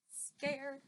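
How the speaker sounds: ordinary delivery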